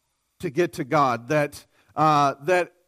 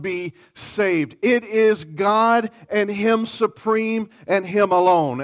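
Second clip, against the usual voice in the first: ringing, slightly raised preaching voice; preaching at full force; 3 semitones higher, 4 dB louder than usual. Acted. Preacher voicing God's claims to the congregation, fervently. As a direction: proclaim it with full conviction.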